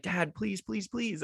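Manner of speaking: put-on high, soft pleading child voice; begging kid. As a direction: beg like a small boy for a Coke. Kid begging dad for Coke.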